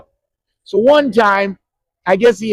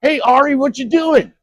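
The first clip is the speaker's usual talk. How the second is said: higher, casual friendly call; imitating Kevin Bacon; 9 semitones higher, 3 dB louder than usual. Actor wanders over from the woods, higher casual greeting. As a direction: saunter up and greet the kid. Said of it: "he comes up".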